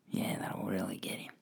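hushed conspiratorial whisper; breathy aside, voice dropped right down; stage whisper. Pretend. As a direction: whisper it like a sly threat. Mock threat after plugging a follower.

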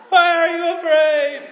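high, loud, laughing sitcom whine of "Why are you afraid?"; a shouted stand-up parody of Jesus; hammed-up Seinfeld. Shown as a demonstration. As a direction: overdo the comic exasperation, laughing through it. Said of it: "he probably didn't say it like that".

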